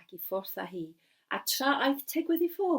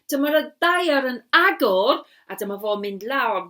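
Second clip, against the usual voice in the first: dramatic boom; 11 dB louder than usual. A narrator's reading.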